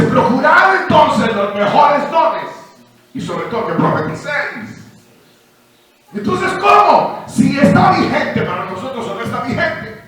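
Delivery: proclamación lenta y fuerte, más aguda; predicación enérgica. Acted. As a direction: proclama con fervor de predicador. Preaching at full force. (predicación a pleno pulmón)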